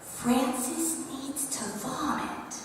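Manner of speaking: put-on slow, soft child's whisper. Teacher imitating a shy girl's urgent whisper. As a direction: whisper it slowly like a worried little girl. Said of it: "Ava whispers to me".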